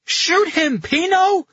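very high, loud urgent cry. A narrator's reading. Carletto cries out as Pino falters with the gun, yelling. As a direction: cry out the order frantically. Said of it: "Carletto cried".

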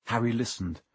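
plain reading voice

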